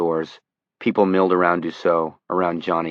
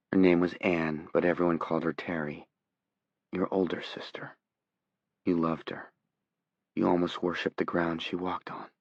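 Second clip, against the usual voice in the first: hushed, soft voice; 8 dB quieter than usual. Acted. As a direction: speak softly, almost a whisper. Johnny's trance speech, hushed.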